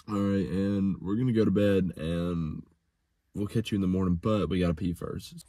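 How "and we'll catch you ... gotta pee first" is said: ordinary delivery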